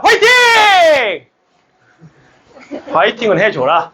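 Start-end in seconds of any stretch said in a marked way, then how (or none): 0.0-1.3 very high, long-drawn cheer; rallying shout. Real, not acted. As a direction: belt it out slowly to fire up the class. 교사가 학생들에게 응원 구호. (높고 길게 외치는 응원 구호)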